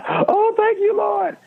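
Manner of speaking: high mock relieved lady voice. Lady in the row thanking the Lord, high mock relief. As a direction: squeal it like the lady who thinks she's off the hook. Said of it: "She says".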